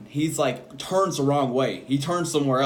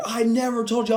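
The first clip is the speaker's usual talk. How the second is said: high-pitched indignant denial; imitated yelling; 9 semitones higher than usual. Coworker denying he gave the order, imitated yelling. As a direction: mimic a coworker hotly denying it. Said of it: "he's yelling and he's laughing".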